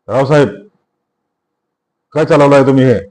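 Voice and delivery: loud, furious protest at the officer; enraged shout. A narrator's reading. Arrested Bali shouts angrily at the inspector. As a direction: Bali yells in outrage at being cuffed. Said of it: "बळी खवळून ओरडला".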